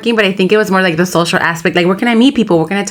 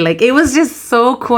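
ordinary talk, nothing marked

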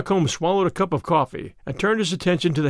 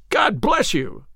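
raised-pitch, heartfelt exclamation; fervent cry; 6 semitones higher, much slower than usual. A narrator's reading. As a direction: cry out in gratitude, without shouting. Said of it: "he cried".

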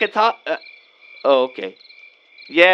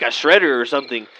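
ordinary talk, nothing marked

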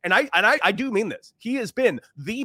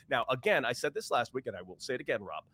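ordinary talk, nothing marked

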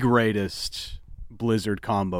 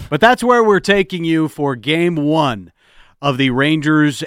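radio sign-off; 2 semitones higher, 4 dB louder than usual. Acted. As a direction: sign off the show like an announcer.

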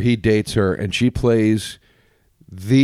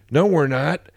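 mock trailer joke voice; 6 semitones higher, much slower than usual.

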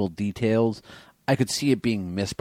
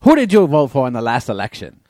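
loud, high newsreel reporter voice; old-timey reporter; 5 semitones higher, 7 dB louder, faster than usual. Pretend. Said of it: "She sounds like an old-timey press".

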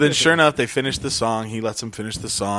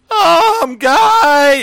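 put-on high, much louder drunken bellow; drunk yell; 11 dB louder than usual. Drunk friend yelling over the band, very loud bellow. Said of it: "Full level voice, as loud as he can".

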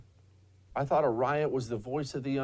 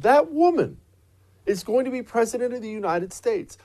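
incredulous; 8 semitones higher, 4 dB louder than usual. Real, not acted. Disbelief she'll be president.

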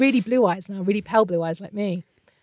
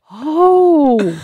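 sudden realization; 7 semitones higher, 7 dB louder than usual. Retold or imitated. Realizing they'd die for child.

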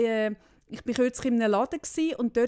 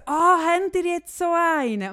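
surprised neighbour; 6 semitones higher, 7 dB louder than usual. Retold or imitated. Neighbour quoted seeing robot vacuum.

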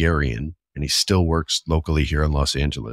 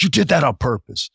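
angry confrontation; 10 semitones higher than usual. Retold or imitated. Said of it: "I was like".

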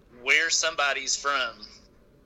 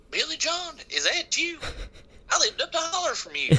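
very high-pitched country neighbor recognizing a caller; hammed-up holler twang, squeaky high; hillbilly accent. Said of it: "I'm talking about our people".

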